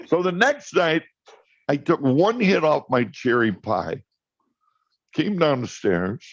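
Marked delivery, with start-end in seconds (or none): none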